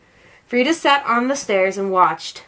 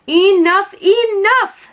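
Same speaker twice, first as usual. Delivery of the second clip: exasperated; 9 semitones higher, 6 dB louder, slower than usual. A narrator's reading. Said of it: "said Mama".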